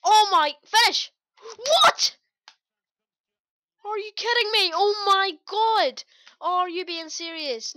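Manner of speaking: very loud, high yells of disbelief; outraged shouting. Real, not acted. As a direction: explode at a missed chance, then stretch out the words. Outraged at a missed chance.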